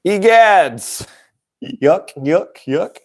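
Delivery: high comic-book exclamation and yuck-yuck chuckle; Archie comics laugh. Retold or imitated. Vlogger imitating Alex laughing like an Archie comic, high and cartoonish. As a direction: play an old cartoon character laughing. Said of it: "like he's reading Archie comics".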